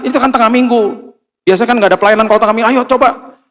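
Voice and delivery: a friend on the phone urging the couple to take the villa, high and fast; chattering friend. Acted. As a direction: gush breathlessly like an excited friend calling. Wife's friend calling, excited.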